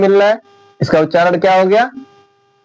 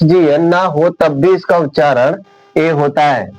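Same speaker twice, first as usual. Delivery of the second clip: ordinary talk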